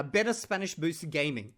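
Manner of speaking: ordinary talk, nothing marked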